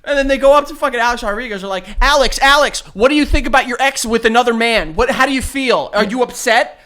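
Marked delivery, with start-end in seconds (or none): 2.0-6.8 loud, higher, pushy questions; nosy paparazzi. Pretend. Paparazzo pestering Alex Rodriguez about his ex, loud and pushy. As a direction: call his name and pester him.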